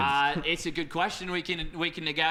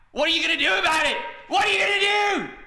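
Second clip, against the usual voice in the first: fast, much higher, louder Aussie yell; mock shout; 10 semitones higher, 4 dB louder, faster than usual. Caster yelling at co-caster in a mock Aussie accent. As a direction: bellow it at your mate in a hammed-up fury.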